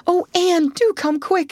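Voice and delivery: high, frightened plea; frightened girl begging Anne to come; nervous pleading. A narrator's reading. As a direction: beg her to hurry, scared and high. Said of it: "implored Diana nervously".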